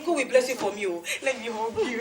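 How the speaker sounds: ordinary talk, nothing marked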